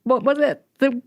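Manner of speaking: high, whiny tearful protest; mock crying. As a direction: act out a panicked, blubbering traveler. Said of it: "Just stop crying".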